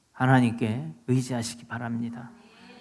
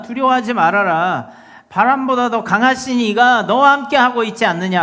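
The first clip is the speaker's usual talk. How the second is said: loud, raised-pitch preaching rebuke; rebuking exhortation; 7 semitones higher, 8 dB louder than usual. Acted. Preacher as Jesus telling disciples not to fear, loud rebuke. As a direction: press them hard to drop their fear. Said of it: "바다만 꾸짖은 것이 아니라".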